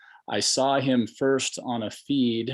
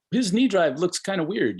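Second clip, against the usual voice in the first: higher-pitched mock commenter voice; quoting a commenter; 8 semitones higher, 3 dB louder than usual. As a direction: quote an online critic, pitched up high. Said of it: "somebody said".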